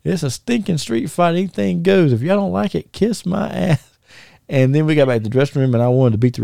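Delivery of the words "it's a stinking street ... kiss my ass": high-pitched impression of a defiant wrestler; imitated microphone announcement. Wrestler on the microphone telling off the crowd, high imitated defiance. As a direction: pitch it up, stretch the final curse. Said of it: "came over to the microphone and said".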